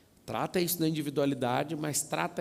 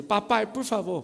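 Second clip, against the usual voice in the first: higher, louder, slow imitated plea; begging child; 5 semitones higher, 5 dB louder, slower than usual. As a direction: beg like a desperate little kid. (criança implorando, imitada)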